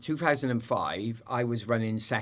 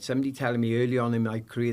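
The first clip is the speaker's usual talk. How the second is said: normal talking voice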